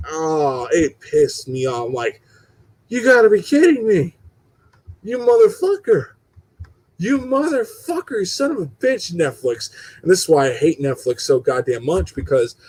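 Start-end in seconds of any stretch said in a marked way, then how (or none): 2.9-9.7 mock fury. Real, not acted. Ranting at Netflix cancelling show.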